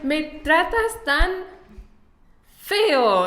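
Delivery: slow, high-pitched mock complaint in Spanish; frustrated. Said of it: "I get so frustrated".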